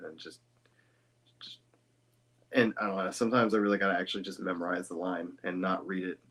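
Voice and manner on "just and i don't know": ordinary talk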